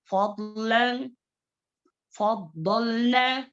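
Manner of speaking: slow फदलना with a heavy dwad; slow teacher demo; recitation demo. Shown as a demonstration. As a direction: model फदलना slowly. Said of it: "इस तरीके से है।".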